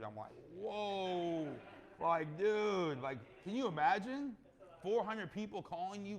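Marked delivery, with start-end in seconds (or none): 0.6-1.5 loud, high drawn-out whoa; loud amazed whoa at four hundred investors; stunned whoa. Retold or imitated. 2.0-6.2 much louder and higher, incredulous; loud amazed disbelief at four hundred callers; excited disbelief. Real, not acted.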